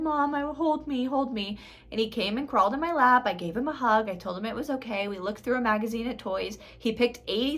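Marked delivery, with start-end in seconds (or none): 0.0-1.6 a hint of a small boy pleading, higher; lightly voiced crying child. Three-year-old son asking mom to hold him, lightly higher pleading. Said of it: "he immediately started crying and he said".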